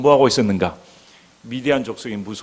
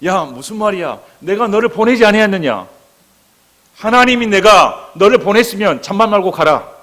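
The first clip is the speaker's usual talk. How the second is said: loud, higher-pitched stern rebuke; firm, resolute command; 7 semitones higher, 7 dB louder than usual. Acted. Jesus ordering a hesitant Gideon to go, resolute and stern. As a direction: cut off all excuses with forceful authority. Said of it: "이 예수님은 단호하십니다".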